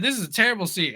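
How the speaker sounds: high-pitched mock complaint on the edge of laughter; put-on high protest, about to crack up; imitating coworker cracking up. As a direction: imitate someone trying not to laugh. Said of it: "he started laughing".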